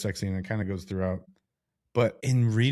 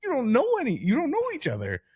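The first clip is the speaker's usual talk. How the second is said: much higher, shocked disbelief voice; incredulous squeal. Retold or imitated. Podcast host imagining fictional lovers skipping condoms, high shocked squeal. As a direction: squeak it out like you can't believe it. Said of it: "In my head, I'm like".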